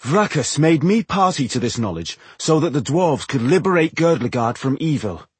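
high-pitched, fervent oratory; impassioned speech. A narrator's reading. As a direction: plead the cause with conviction. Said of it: "end of his impassioned speech".

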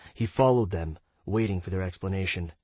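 ordinary delivery

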